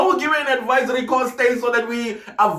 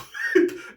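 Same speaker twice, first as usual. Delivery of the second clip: high squealing laugh; 4 dB quieter than usual. Real, not acted. Burst of laughter alone.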